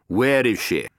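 villain interrogates his captive; menacing interrogator. A narrator's reading. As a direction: bear down on the prisoner. Harper interrogates Venture.

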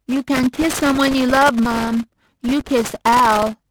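put-on high, loud, slow childlike insistence; Phoebe's voice. A narrator's reading. Phoebe arguing with her mom, high and slow. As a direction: plead your case like a stubborn child.

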